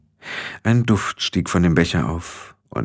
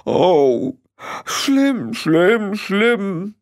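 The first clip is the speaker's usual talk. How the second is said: hammed-up, very high wailing; comic grief-stricken moaning; sobbing; 13 semitones higher, much slower than usual. A narrator's reading. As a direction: bewail each word in a high voice. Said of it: "schluchzte er".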